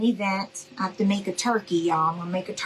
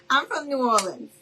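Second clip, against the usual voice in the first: normal talking voice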